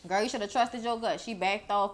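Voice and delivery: ordinary talk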